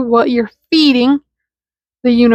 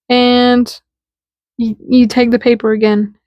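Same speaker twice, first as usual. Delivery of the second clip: ordinary talk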